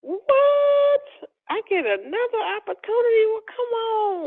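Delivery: astonished and close to tears, slowing on the last words; tearful disbelief. Real, not acted. Reliving news of second offer.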